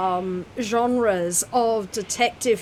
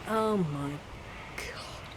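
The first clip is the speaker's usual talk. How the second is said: whispered oh my God; 5 semitones lower, 11 dB quieter, slower than usual. Real, not acted. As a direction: drop to a breathless whisper on "God". Exasperated memory of reading poetry.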